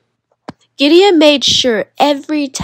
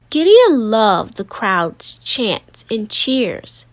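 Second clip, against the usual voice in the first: plain reading voice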